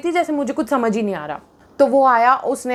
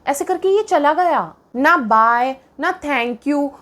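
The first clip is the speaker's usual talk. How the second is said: ordinary talk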